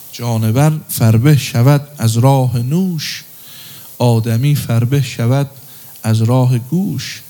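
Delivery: slow, measured verse reading; slow poem recitation. Acted. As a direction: recite the couplet slowly, each word weighed.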